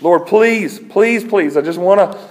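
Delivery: loud, high, insistent pleading; hammed-up desperate plea; acted praying. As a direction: beg urgently, raising the voice. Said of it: "Talk about praying in faith".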